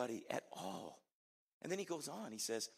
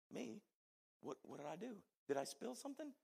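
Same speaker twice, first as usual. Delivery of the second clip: baffled surprise; 10 semitones higher, 4 dB quieter than usual. Retold or imitated. Youth pastor recalling his shock, high baffled squeak. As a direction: voice jumping high in disbelief.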